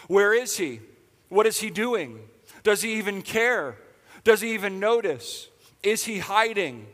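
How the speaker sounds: preaching. Real, not acted. Pastor challenging the congregation about God, urgent. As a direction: press each question on the congregation.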